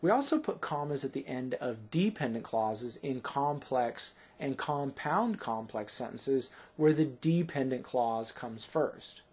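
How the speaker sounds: usual speaking voice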